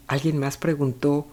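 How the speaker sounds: ordinary delivery, nothing marked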